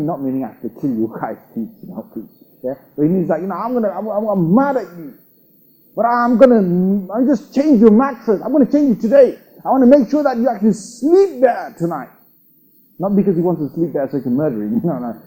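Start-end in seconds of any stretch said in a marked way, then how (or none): 3.6-12.1 high, loud mock scolding; angry father. Father mad at his son yet buying him things, acted anger. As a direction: rant at the son with raised pitch and volume. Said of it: "that father is angry with his son".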